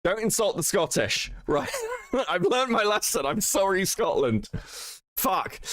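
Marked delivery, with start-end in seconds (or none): 1.7-2.2 sudden high-pitched laugh. Real, not acted. Laughing at own joke.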